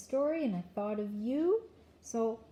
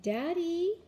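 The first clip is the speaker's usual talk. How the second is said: higher rising call, slightly firmer; child's second try, raised a little; a little louder; 8 semitones higher than usual. A narrator's reading. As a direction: call a bit more insistently to wake him. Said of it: "Luke said it a little louder".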